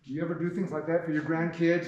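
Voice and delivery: usual speaking voice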